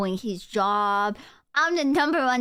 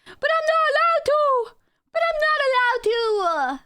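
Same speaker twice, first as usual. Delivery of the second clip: squeaky, whining, much higher and louder; whiny high voice; 13 semitones higher, 3 dB louder than usual. Pretend. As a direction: whine it like a sulky kid.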